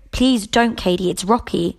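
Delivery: ordinary delivery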